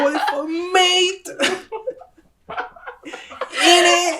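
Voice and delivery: put-on British accent. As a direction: drag out "mate" in a mock British accent. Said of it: "[name]'s impression of a British accent".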